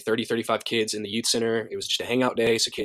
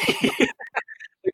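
high, breaking laugh. Real, not acted. Man telling how dad took his punch, laughing high. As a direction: burst out laughing at your own punchline.

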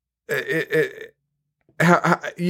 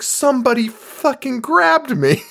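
higher, outraged whine; a girlfriend snapping back at her sulking boyfriend, indignant and higher; indignant protest; 10 semitones higher than usual. Retold or imitated. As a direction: protest like you were wronged. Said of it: "And she's like".

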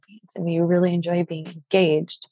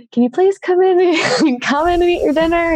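high, louder mock-exasperated plea; exasperated pleading; 11 semitones higher, 5 dB louder than usual. Boyfriend calling her in from taking pictures to eat, exasperated. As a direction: beg impatiently, breaking into a laugh.

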